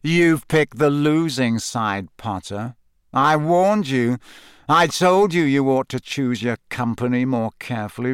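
arrogant, menacing rival. Acted. Malfoy threatening Potter and his friends, arrogant menace. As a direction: gloat as you warn them, stretching key words.

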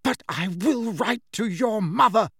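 high, angry threat; indignant, emphatic ultimatum; quivering with anger. A narrator's reading. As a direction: deliver the threat pitched well up. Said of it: "her voice quivering with anger".

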